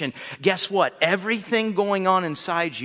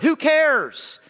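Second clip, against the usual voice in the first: usual speaking voice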